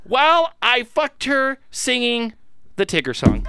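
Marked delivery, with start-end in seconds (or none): 0.0-2.3 excited. Real, not acted. Shocked emphatic punchline.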